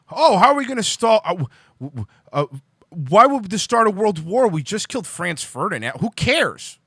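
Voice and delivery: high, loud, dopey mocking voice; sneering parody of a naive politician; mock clueless leader. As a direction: play the oblivious fool brushing off war. Mocking pre-WWI leaders' complacency.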